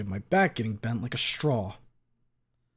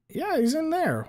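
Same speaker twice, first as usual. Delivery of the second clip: high bro voice; 4 dB louder than usual. Acted. Leon voice.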